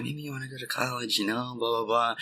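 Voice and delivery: lightly imitating his mother, softly; mock mom voice. Mom's advice retold in story.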